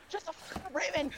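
very high, squawking mock fury; hammed-up high-pitched outburst; pissed-off teacher. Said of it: "I'm pissed already".

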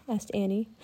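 plain reading voice

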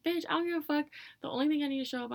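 high, loud indignant outburst; angry retort. Acted. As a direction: explode back at the lecture. Acted retort to punctuality demand.

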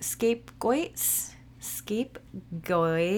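New Jersey accent, "scapegoats" drawn out as "skapegoyts"; slow, questioning New Jersey "scapegoats". Real, not acted. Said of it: "I got that New Jersey thing go-, going on".

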